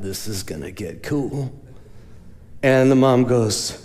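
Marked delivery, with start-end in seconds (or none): 0.0-1.5 low, soft aside under the breath; quiet excited aside; hushed aside. Retold or imitated. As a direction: murmur it to yourself, eager. Aside while eavesdropping on daughter.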